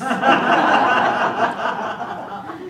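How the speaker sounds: a loud, gleeful evil cackle; loud villainous cackle. Acted. Widow cackling to the Toastmasters over her poisoned husband. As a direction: cackle with delight at the crime. Gleeful laugh after confessing murder.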